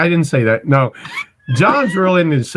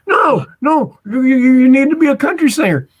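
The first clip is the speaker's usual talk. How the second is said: high, pushy father, lightly voiced; insistent dad voice; 10 semitones higher than usual. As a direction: press her like a stubborn dad. Voicing Pam's dad insisting.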